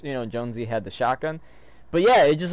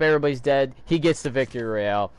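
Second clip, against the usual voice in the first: usual speaking voice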